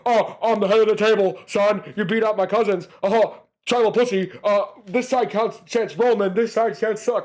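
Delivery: lightly hammed-up wrestler impression; imitating the Rock. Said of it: "just having Rock go".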